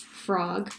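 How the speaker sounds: ordinary delivery, nothing marked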